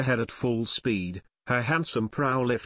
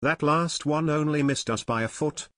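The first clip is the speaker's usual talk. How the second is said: usual speaking voice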